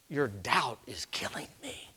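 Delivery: soft half-whispered reproach; quiet, pained rebuke; grieved, hushed. Acted. Voices the grieved Jesus to doubters.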